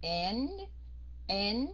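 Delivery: N drawn out and rising; held nasal ending. Shown as a demonstration. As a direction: keep N open in the nose, rising. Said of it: "Hai chữ này đọc khác". (N ngân mũi, lên giọng)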